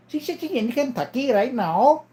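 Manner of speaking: high put-on Indian accent, rising at the end; mock Indian-accented relay. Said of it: "an Indian guy".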